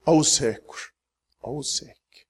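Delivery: fading to hushed. Acted. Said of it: "og sier".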